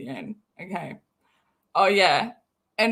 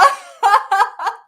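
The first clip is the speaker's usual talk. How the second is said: loud, high burst of laughter; real laughter; 10 semitones higher, 8 dB louder than usual. Real, not acted. Laughing at Katie's mistake, loud high peals. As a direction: crack up loudly and high.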